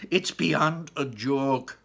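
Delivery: slow, lightly voiced Mr Gloop; grumbling father. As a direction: complain heavily. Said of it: "said Mister Gloop".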